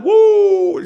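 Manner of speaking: loud high cheer; excited whoop. Real, not acted. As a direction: whoop it out to the caller. Cheering a listener's hometown.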